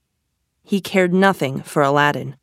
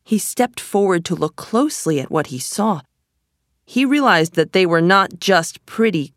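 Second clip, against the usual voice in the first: usual speaking voice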